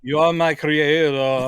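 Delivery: put-on slow heavy Swedish accent. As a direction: drag out creator in a Swedish accent. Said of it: "thickest Swedish accent".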